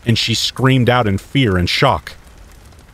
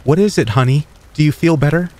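caring mom; 7 semitones higher than usual. A narrator's reading. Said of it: "Mom asked me".

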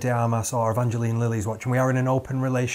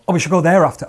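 loud, higher insistent repeat; unhelpfully repeating in a raised voice; same sound repeated; 5 semitones higher, 9 dB louder, faster than usual. Shown as a demonstration. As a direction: parrot it forcefully without making it clearer. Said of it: "I don't do the exact same sound".

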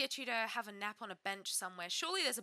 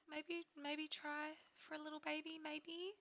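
coy; 4 semitones higher, 11 dB quieter than usual. Pretend. Coaxing sims to try for baby.

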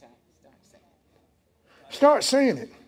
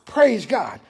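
ordinary talk

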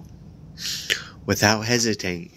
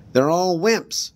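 higher, slow sneering bully voice; Conan; 9 semitones higher, 4 dB louder, slower than usual. A narrator's reading. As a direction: scorn your teammates. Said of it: "Evan heard Conan say".

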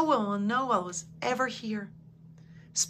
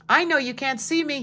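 calling out, higher and louder; shouted; 6 semitones higher, 5 dB louder than usual. A narrator's reading. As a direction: shout so the flower can hear. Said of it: "he shouted".

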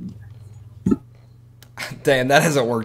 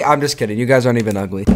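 ordinary talk

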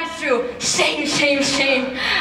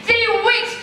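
exclaiming; 8 semitones higher than usual. Acted. Mother exaggerates the wait.

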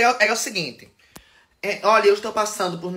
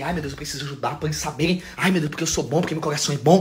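mock hand-wringing; 6 semitones lower, 4 dB quieter, faster than usual. Mocking their own former people-pleasing self.

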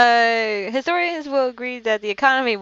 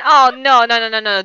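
dismayed protest; 3 semitones higher, 10 dB louder than usual. Real, not acted. Opponent about to win.

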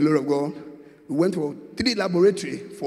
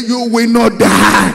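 loud, drawn-out preacher's shout; forceful pulpit decree; shouted declaration; 2 semitones higher, 10 dB louder, slower than usual. Real, not acted. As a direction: shout it over the congregation, slowly. Repeats declaration louder to congregation.

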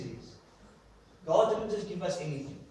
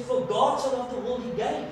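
mother reading aloud; 7 semitones higher, 5 dB louder than usual. Retold or imitated. Mother reading the note, voiced.

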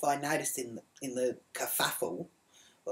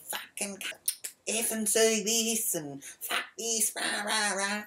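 high, strained imitation of a screaming rant; slow, pitched-up mock tantrum; 8 semitones higher, slower than usual. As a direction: mimic her being dragged off cursing, without full volume. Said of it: "kicking and screaming the whole way".

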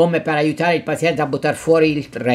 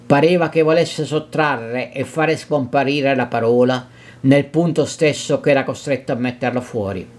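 usual speaking voice

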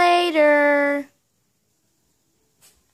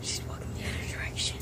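whispering; 17 dB quieter, much faster than usual. Real, not acted. Sneaking past someone, whispering.

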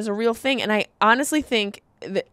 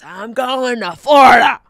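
slow, loud shouted threat; mock dad shout; 3 semitones higher, 9 dB louder than usual. Fed-up dad threatening to flee winter, slow shout.

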